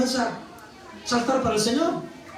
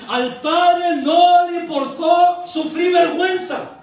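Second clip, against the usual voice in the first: raised, emphatic sermon delivery; impassioned preaching; 6 semitones higher than usual. Real, not acted. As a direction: drive each point home to the congregation. Preaches at full force. (predicación enérgica y apasionada)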